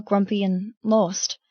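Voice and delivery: usual speaking voice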